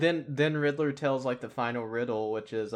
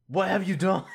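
higher, louder mock demand; imitating an angry Batman; 7 semitones higher, 5 dB louder than usual. Batman demanding answers from Riddler, mock angry shout. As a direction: bark it like a furious Batman. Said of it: "he's just like".

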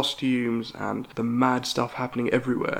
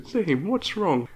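higher, slow, concerned mother's voice; caring mum; 6 semitones higher, much slower than usual. Retold or imitated. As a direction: gently ask a sulking child what's up. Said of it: "Mum goes up to Zane and goes".

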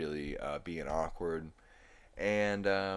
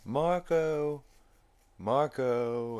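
higher, louder repeated calls; playful Marco Polo calls; calling out; 6 semitones higher, 4 dB louder, much slower than usual. Retold or imitated. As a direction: call out across the pool. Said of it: "And he's like".

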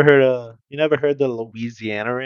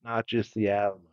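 lower, slow Texas drawl; Southern accent; 3 semitones lower than usual. Pretend. Riffing on Texas, light slow Southern accent. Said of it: "talking real slow".

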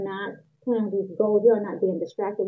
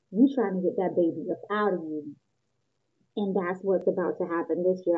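ordinary talk, nothing marked

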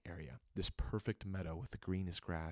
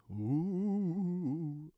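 mock spooky; 10 semitones higher, 6 dB louder than usual. Mock spooky after fourth dimension.

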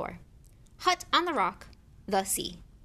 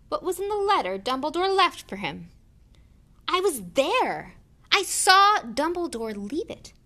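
high, loud, mounting accusation; rage; 8 semitones higher, 8 dB louder than usual. A narrator's reading. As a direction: let the rage burst out loud. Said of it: "every syllable trembled with rage".

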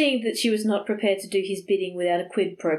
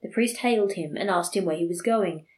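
ordinary delivery, nothing marked

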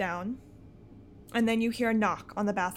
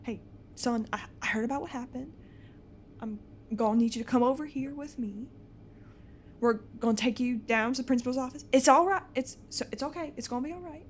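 soothing dad; 3 semitones higher, 4 dB quieter, faster than usual. Acted. Father soothing upset son.

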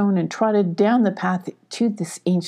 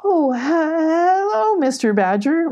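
high, stretched-out nervous hello; overly polite high greeting; trying to be friendly; 10 semitones higher, 3 dB louder, slower than usual. Acted. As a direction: greet with forced friendliness, pitch way up. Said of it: "trying to be friendly".

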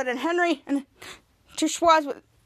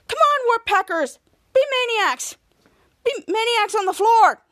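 high-pitched coach's cheer; sideline rallying; 7 semitones higher, 3 dB louder than usual. Retold or imitated. Henry coaching from the sidelines, rallying cry. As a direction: urge the players on from the bench.

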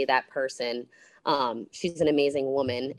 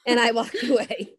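laughing repeat; 7 semitones higher, 6 dB louder than usual. Real, not acted.

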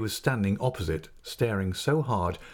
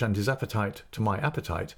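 ordinary delivery

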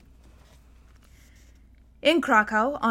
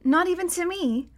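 hurt; 7 semitones higher than usual. A narrator's reading. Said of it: "Scarlet looked hurt".

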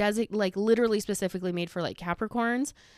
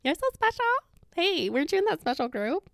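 mocking sing-song praise; 6 semitones higher than usual. Taunting former gifted kids who went to jail, mock-admiring. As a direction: tease with exaggerated high sweetness.

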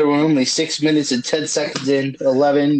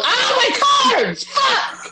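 frustrated yell; slower than usual. Real, not acted. Losing cards in game.

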